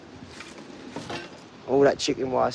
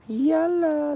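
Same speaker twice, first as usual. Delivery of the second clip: high call to dog. Real, not acted. Calling dog.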